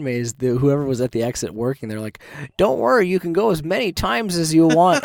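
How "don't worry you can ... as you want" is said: higher, louder, chirpy reassurance; a bright, upbeat staff member; mock cheerful worker. Exit worker cheering on unhappy visitors, mock chirpy voice.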